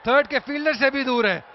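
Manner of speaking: loud live play-by-play; excited cricket commentary. Real, not acted. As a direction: call each boundary with a shout. Shots called live. (उत्साहित cricket commentary)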